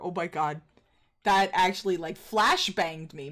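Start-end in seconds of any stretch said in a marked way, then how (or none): none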